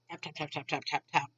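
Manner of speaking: soft, low rattle of taps; quiet, rapid-fire keyboard imitation; imitated typing. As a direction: mimic busy keys under your breath. Imitates clerk's typing on computer.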